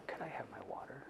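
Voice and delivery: quick voiceless whisper off-mic; whispered aside. Real, not acted. Speaker whispers to her helper for water, real whisper. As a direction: whisper the request so the audience barely hears.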